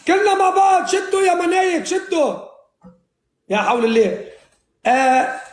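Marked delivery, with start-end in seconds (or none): 0.1-2.3 shouting. Real, not acted. Rallying teammates during game.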